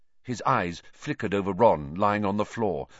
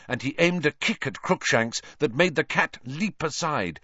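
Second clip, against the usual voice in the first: usual speaking voice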